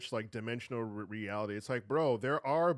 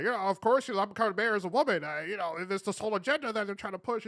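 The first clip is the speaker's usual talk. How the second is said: higher mocking quote; mocking the YouTuber; 6 semitones higher than usual. Mocking a YouTuber's agenda claim, higher voice. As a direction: parody his agenda talk. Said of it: "you're talking about".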